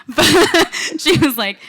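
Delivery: laughing through the words, high and loud. Real, not acted. Speaker laughing at her own horrible accent, high and loud. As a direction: break up at your own bad accent.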